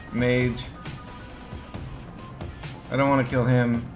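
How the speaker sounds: ordinary talk, nothing marked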